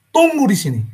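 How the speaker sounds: a furious father holding himself back; father sternly tells his son to wait for his punishment. Acted. Angry father orders son.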